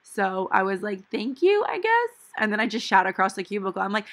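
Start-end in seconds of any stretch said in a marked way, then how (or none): 1.1-2.1 high, rising, unsure thank you; awkward thanks. Retold or imitated. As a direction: squeak out a confused thanks. Awkward reply to backhanded compliment.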